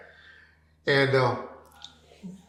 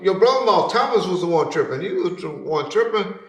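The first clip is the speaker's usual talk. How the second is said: normal talking voice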